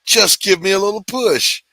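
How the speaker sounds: high, loud mock plea to buddies; calling for help. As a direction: call out as if from a stalled car. Acts out begging buddies for push.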